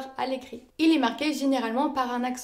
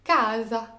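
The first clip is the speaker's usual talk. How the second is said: "Casa" with a long stressed CA; penultimate stress; 2 semitones lower than usual. Shown as a demonstration. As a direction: stretch CA, drop lightly onto "sa" in "Casa". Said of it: "forcément sur l'avant-dernière syllabe". (« Casa » accentué sur CA)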